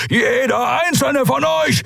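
drawn-out, high, furious bellow; bellowed threat; thundering shout. Acted. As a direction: roar it at all of them, slowly. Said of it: "donnerte Keru".

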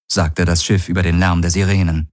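ordinary delivery

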